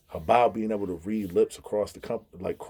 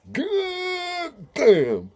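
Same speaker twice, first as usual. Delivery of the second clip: deaf friend's voice. Retold or imitated. Telling about a deaf friend spotting police, high mock deaf voice. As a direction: drag out god damn like his deaf buddy. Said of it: "He was like".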